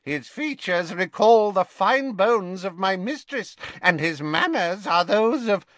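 put-on an old elf praising his young master; croaked. A narrator's reading. Creature keeps praising Malfoy.

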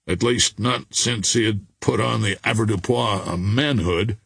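usual speaking voice

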